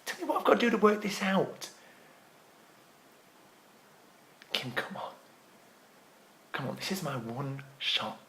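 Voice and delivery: very soft, low, desperate begging; subdued, breath-held coaxing; hushed pleading. Acted. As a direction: beg gently, almost down to a whisper. Desperate plea to Kim to stay.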